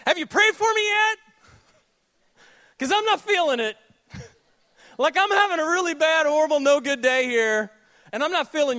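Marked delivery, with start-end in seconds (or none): 0.0-6.6 high, loud, whiny demand; pushy early morning caller; mock phone demand. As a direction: needle the friend who never prays. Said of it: "dial someone's number at five A M and say".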